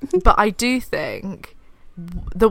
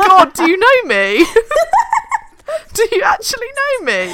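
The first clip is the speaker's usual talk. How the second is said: higher and louder, laughing through it; laughing disbelief; 6 dB louder than usual. Real, not acted. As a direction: laugh it off, outraged that they got it so wrong. Friend guesses her crush wrong.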